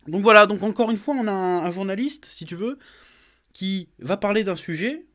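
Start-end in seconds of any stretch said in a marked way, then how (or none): none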